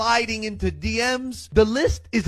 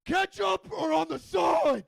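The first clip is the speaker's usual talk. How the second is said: high, slow shout to the back; shouted question; 9 semitones higher, 3 dB quieter than usual. Retold or imitated. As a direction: call back to the passengers. Parent calling across the car.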